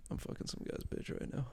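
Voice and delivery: soft unvoiced whisper; whispered inner thought. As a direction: whisper it like a guilty secret. Guy's inner thought acted out.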